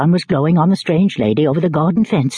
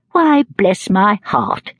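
put-on slow, higher surprised old lady; stretched-out amazed aunt; surprised exclamation; 6 semitones higher, much slower than usual. A narrator's reading. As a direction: exclaim it in astonishment. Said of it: "exclaimed Miss Betsy".

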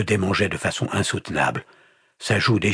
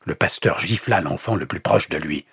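ordinary delivery, nothing marked

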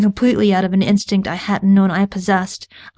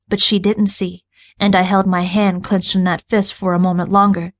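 ordinary delivery

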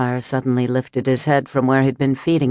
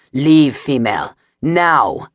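commanding order; 4 dB louder, slower than usual. Acted. Reichin commands her to flee.

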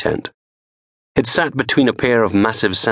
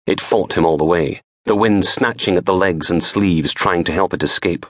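ordinary delivery

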